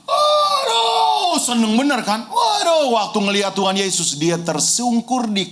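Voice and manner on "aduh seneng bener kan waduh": joyful crying shout. Retold or imitated. Healed leper running back to Jesus, tearful joyful shout. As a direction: burst out in tearful joy. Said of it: "sambil berteriak".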